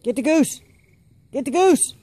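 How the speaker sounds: very high, urgent fetch command; high, loud, excited animal call. Real, not acted.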